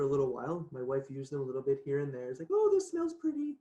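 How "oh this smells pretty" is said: high-pitched mock cooing over a scent; imitated delight. Voicing wife's delight at oils.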